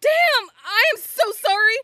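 very loud, high-pitched apology; alarmed apology. Acted. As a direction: blurt it out at full volume, mortified. Apologizes after screaming too loud.

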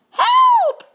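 very high, loud cry for help; scream. A narrator's reading. Missus Scott sees a mouse on the shelf, screaming. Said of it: "screamed Missus Scott".

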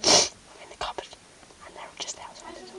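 barely audible whisper; whispering while hiding. Real, not acted. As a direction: breathe the words silently. Hiding in cupboard, seekers outside.

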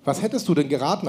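ordinary talk, nothing marked

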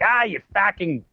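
put-on Boston accent, heckling. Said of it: "that's a pretty good Boston accent there".

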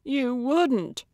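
high-pitched, aghast protest; appalled and disbelieving; horrified disbelief. Acted. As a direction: say it as if betrayed, voice lifted high. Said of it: "horrified at the prospect of such a betrayal".

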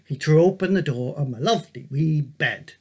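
ordinary delivery, nothing marked

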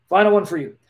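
ordinary talk, nothing marked

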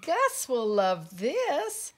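delighted announcement. Acted. Pip offering a new gift for Gus, delighted announcement. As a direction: draw it out loudly with delight. Pip presents another find.

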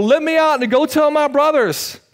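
lightly voiced rich man's plea; rich man pleading. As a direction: beg to be let out. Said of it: "crying out and saying".

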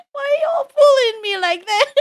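high squealing protest through laughter; laughing protest. Real, not acted. Streamer protesting to teasing chat, laughing and squealing. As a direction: laugh it out, half shrieking. Chat drags her flat back.

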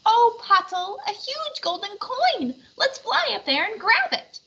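high squeaky little puppet; tiny puppet voice. Acted. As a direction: play the diminutive Half Pint. Puppeteer voices Half Pint puppet.

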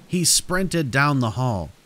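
ordinary delivery

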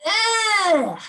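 high, loud cry of disgust; a squeal of yuck; girl's disgusted ugh. A narrator's reading. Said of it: "said Billy".

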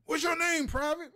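loud, high barked drill sergeant; a drill sergeant screaming at a private; drill sergeant yell. Retold or imitated. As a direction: scream it in a recruit's face. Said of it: "because, like".

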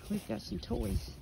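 usual speaking voice

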